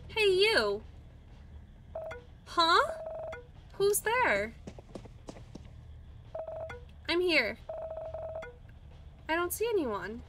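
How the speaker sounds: lightly voiced cheeky plant; sassy plant voice. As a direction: call out impatiently as a tiny plant. Plant character calls out.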